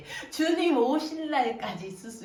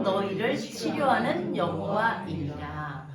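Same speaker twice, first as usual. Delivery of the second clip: loud, measured congregational Bible reading; scripture reading; 4 dB louder than usual. Acted. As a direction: read the verse out together, firmly and evenly. Reads scripture aloud with congregation.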